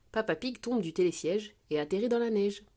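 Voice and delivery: ordinary delivery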